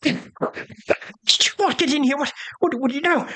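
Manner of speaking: high, panicked, tearful servant voice; a terrified valet babbling through tears; sobbing valet. Acted. Valet weeps to the intruders in panic, high and tearful. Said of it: "he kinda just collapses and he's crying".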